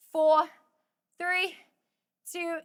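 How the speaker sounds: four called loud and high; shouted countdown. Real, not acted. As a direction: shout the numbers to push the last seconds.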